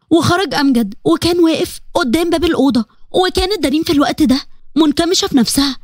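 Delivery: ordinary delivery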